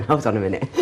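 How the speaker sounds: ordinary talk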